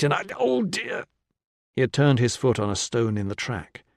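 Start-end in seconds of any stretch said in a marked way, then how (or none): 0.0-1.0 pained wince. Acted. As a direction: voice jumps up on a pained oh. Injured man wincing in pain.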